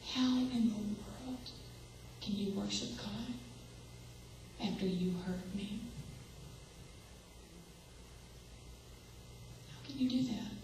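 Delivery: hushed, hurt private question; quiet inner thought. Retold or imitated. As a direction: say it under the breath as if only thinking it. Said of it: "I'd think in my heart".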